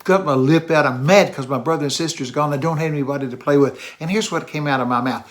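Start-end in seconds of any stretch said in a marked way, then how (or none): none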